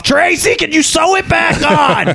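high, strained comic shout; mock calling out. Imitating the announcer calling Tracy to help, mock shout. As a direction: yell it far up in pitch, hammed-up.

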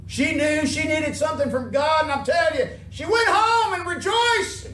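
loud, high preacher's cry, building; fervent pulpit shout; full-force preaching. Real, not acted.

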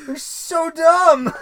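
laughing. Real, not acted. Laughing at the dumb scene.

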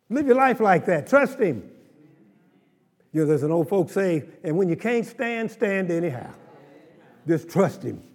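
rousing pulpit exhortation; full-force preaching. Real, not acted. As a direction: lift the church with it.

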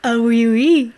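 loud, hammed-up French "oui, oui"; playful fake French cheer; mock French. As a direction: toss it off like a cartoon Frenchman.

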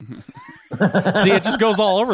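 a drifting, slightly Irish lilt; Irish accent. Acted. Host joking his Scottish accent became Irish. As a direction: let the accent wander toward Irish. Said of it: "Now I'm Irish".

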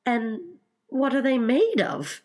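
high, eager questioning; great curiosity. A narrator's reading. Alice asks about undersea shoes, very curious. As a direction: lift it high with wondering interest. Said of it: "in a tone of great curiosity".

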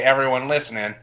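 normal talking voice

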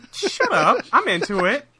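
high, loud comeback to teasing; playful protest. Real, not acted. Co-host teased over repeated conversation, playful protest. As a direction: fire back loudly, pitch jumping up.

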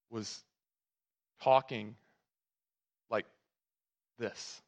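long pauses between the words; halting slow talk. Retold or imitated. Preacher mimicking the dad avoiding swearing, painfully slow. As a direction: imitate someone talking as slow as he can, word by word. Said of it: "Talking as slow as he could".

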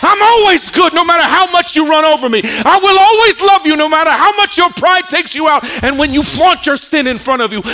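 fiery sermon climax, voice ringing; preaching at full force. Acted. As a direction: cry it out over the congregation. Preacher building to God's promise.

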